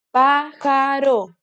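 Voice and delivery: slow pájaro, stress on pá; syllable by syllable. Shown as a demonstration. Teacher sounding out pájaro for children, slowly. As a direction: draw out pájaro with pá leaned on. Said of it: "¿Dónde se carga la voz?".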